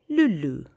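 lulu said with two clear oo sounds. Shown as a demonstration. Teacher shows pupils how to say lulu, oo sounds. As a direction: pronounce lulu as loo-loo, slowly. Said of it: "The A sound becomes a oo".

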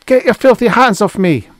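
put-on high, loud, indignant girl; outraged high-pitched outburst; angry. A narrator's reading. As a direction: snap it out angrily, high-pitched and forceful. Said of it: "it whispered angrily".

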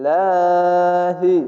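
লাহি with a light, thin l, drawn very slow; thin Allah sound. Shown as a demonstration. Teacher shows students the thin লাহি again. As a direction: recite লাহি with a thin l and long vowel. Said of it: "পাতলা করে পড়বেন".

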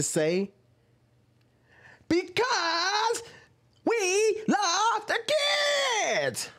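very high, drawn-out parody of a TikToker; exaggerated character voice. Creator mocking how a TikToker talks, exaggerated high character voice. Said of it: "talking like that".

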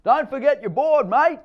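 put-on high, loud call after the boy; crying after him. A narrator's reading. Father shouting after his son to take his board, high and loud. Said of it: "David cries after him".

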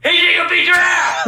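angry yell across to her; real belligerent shouting; threatening shout. Real, not acted. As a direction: holler it as a taunt. Yelling threats at the neighbour.

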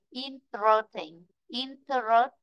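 "interesting" drawn out slowly, every syllable clear; read slowly. Shown as a demonstration. Teacher slows the word for the class, read slowly. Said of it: "Còn nếu đọc chậm lại này".

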